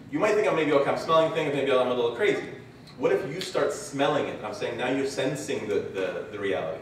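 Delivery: ordinary talk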